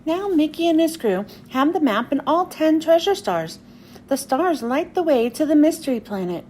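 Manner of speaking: ordinary delivery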